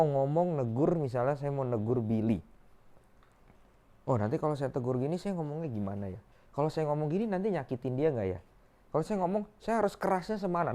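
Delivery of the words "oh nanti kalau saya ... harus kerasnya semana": inner monologue. Acted. Inner thoughts before scolding Billy.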